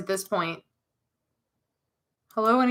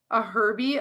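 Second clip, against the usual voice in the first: ordinary talk, nothing marked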